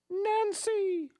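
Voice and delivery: high, drawn-out goddess call; Mother Nature voice. Pretend. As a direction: call the name like a lofty goddess. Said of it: "What would Mother Nature's voice sound like?".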